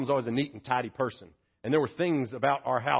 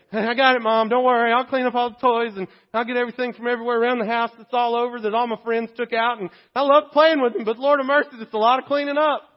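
put-on young self replying; 10 semitones higher, 10 dB louder than usual. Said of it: "And I go".

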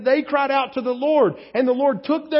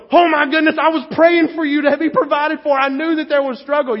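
higher and louder, delighted; excited gush; 6 semitones higher, 5 dB louder than usual. Retold or imitated. Friend who prayed hears the good news, excited. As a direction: burst out with joy for a friend. Imagined praying friend rejoicing.

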